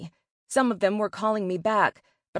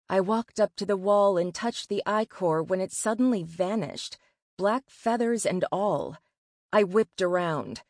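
plain reading voice

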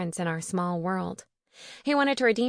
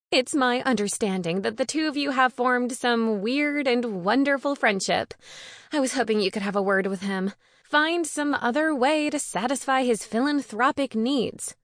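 high, prissy complaint in character; put-upon, higher-voiced busybody; huffy, bossy woman; 4 semitones higher than usual. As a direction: make a pointed request, pitched up. Narrator voices Darcy.

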